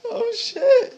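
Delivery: high yelp of real pain; stinging pain. Real, not acted. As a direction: yelp as the pellet hits. Just shot, reacting to sting.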